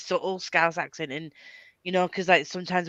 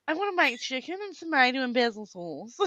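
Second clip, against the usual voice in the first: high put-on presenter voice; lilting mock TV-chef accent; Lloyd Grossman; 6 semitones higher, 4 dB quieter than usual. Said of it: "sounds anything like Lord Grossman".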